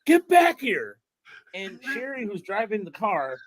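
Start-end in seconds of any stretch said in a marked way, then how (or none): 0.0-1.0 high, raised-voice yell; imitated yell. Man on a scooter calling after his runaway dog, imitated yell. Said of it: "the guy's yelling at the scooter".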